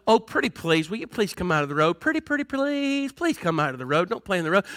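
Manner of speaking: soft, sing-song wheedling, pitch swooping; mock pleading parent. Pushover parent to child playing in the road, mock pleading. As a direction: coax weakly, as a parody. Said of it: "you as a parent do not go".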